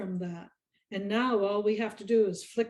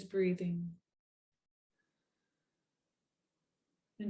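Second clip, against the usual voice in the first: guided meditation. Acted. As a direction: lead them gently through each breath, unhurried.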